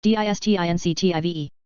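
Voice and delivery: letters rattled off fast; quick spelling. Shown as a demonstration. Teacher spelling the word fast for the class. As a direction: run through the letters briskly. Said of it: "spelling drill".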